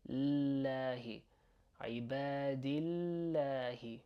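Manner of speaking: "lahi" with the l held long; slow "عباد الله", lengthened double l; doubled L. Shown as a demonstration. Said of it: "we have that doubling L sound".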